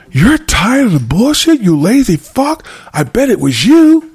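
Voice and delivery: high, drawn-out outraged accusation; mad dad. Acted. Dad accusing his child over the freezer, furious and high. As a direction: throw it back slowly, high and furious. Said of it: "dad gonna get mad and say".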